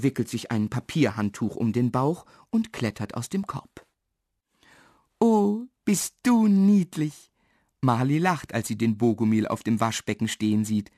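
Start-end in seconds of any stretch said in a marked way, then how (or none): 5.2-7.3 high, slow, loud coo of delight; drawn-out, high-pitched adoring squeal. A narrator's reading. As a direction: gush over something tiny and cute. Girl delighted by tiny dwarf.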